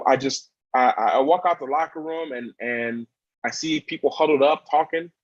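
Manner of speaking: ordinary talk, nothing marked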